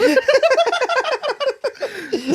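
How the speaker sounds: high, loud cackling laugh; long high burst of laughter; real laughter. Real, not acted. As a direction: crack up helplessly. Cracks up at own impression.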